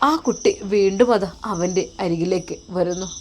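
ordinary delivery

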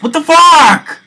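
furious high scream; raging gamer. Real, not acted. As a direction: scream it at the screen.

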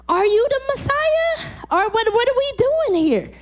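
very high, pleading question; desperate cry. Acted. Disciples confront Jesus.

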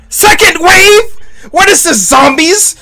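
high-pitched disbelieving questions; incredulous outburst. Real, not acted. Viewer seeing a second wave, incredulous. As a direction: squawk it out, unable to believe it.

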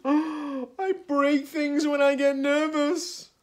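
gasp, then high, loud, slow confession; nervous panic. A narrator's reading. Hippo caught breaking cookies, nervously panicked. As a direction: blurt it out in a panicky squeal.

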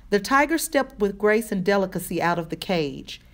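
ordinary delivery, nothing marked

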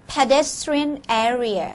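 slow, careful model of "Pedestrian area."; model pronunciation. Shown as a demonstration. Teacher modelling "Pedestrian area." for the class to repeat. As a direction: stretch out "Pedestrian area." so the class can copy. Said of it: "ออกเสียงพร้อมๆ กัน".

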